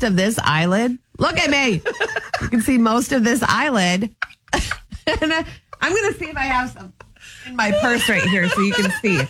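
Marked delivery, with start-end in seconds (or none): 4.5-5.2 breathy laugh. Real, not acted. Friends laugh at her insecurity.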